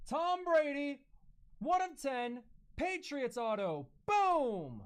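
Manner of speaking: loud, high hype announcing; excited call-out. Real, not acted. Breaker announcing a Tom Brady auto, excited call-out. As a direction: belt out the card with a punchy boom. Calls out a big card pull.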